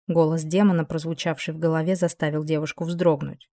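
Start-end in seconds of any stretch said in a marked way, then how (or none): none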